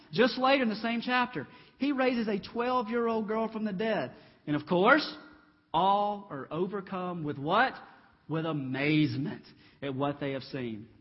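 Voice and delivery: usual speaking voice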